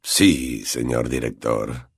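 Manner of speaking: very low, slow, quiet drawl; Snape's voice. A narrator's reading. Snape replies to the headmaster, low and cold. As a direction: answer coldly, barely bothered.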